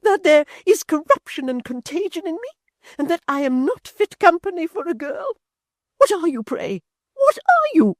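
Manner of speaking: put-on high, rising outraged old mother. A narrator's reading. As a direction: fling the question at her daughter. Mother shrieking at daughter.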